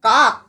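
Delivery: কাক said loudly, stopping on the final k; blocked final sound. Shown as a demonstration. Teacher showing pupil how the breath gets blocked, stopped hard. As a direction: punch কাক and shut off the breath at k. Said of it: "আটকিচ্ছিস".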